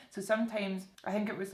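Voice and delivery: ordinary talk, nothing marked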